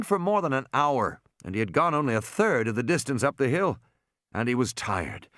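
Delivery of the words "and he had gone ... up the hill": ordinary delivery, nothing marked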